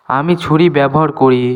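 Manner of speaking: normal talking voice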